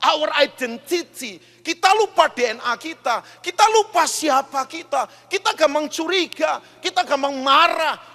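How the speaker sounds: loud, high, driving sermon climax; full-force preaching. Acted. Pastor urging the congregation, loud impassioned preaching. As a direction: preach it out, rising and hammering each line.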